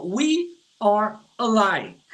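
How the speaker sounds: "We are alike" said very slowly and clearly; slow teaching model sentence; slow example. Shown as a demonstration. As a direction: spell it out word by word for learners. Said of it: "Se eu falo assim, ó".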